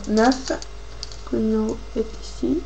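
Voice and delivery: usual speaking voice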